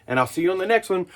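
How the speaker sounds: normal talking voice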